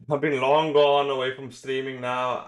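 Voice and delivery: ordinary talk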